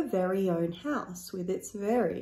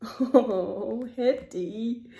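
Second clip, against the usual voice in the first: laughing; much slower than usual. A narrator's reading. Laughs after burp.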